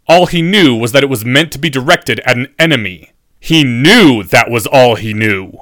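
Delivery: loud, emphatic scathing teacher; furious professor. A narrator's reading. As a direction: drive it home loudly and angrily.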